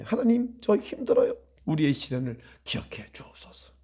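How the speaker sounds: soft whispered prayer to God. Shown as a demonstration. Pastor showing how to pray in hardship, in a whisper. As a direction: breathe the plea quietly. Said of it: "힘들 때는 하나님 앞에".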